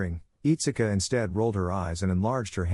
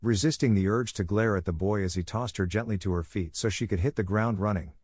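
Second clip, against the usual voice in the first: usual speaking voice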